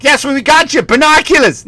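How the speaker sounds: high, exasperated dad impression; Boston dad. Dad to son over the present, high exasperated insistence. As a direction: insist in a put-on Boston accent. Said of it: "then the dad's like".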